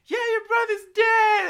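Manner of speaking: high, loud mock wail; hammed-up sobbing mother's cry; imitating crying mom. Retelling mom confirming the news, high loud mock wail. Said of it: "she was, like, crying".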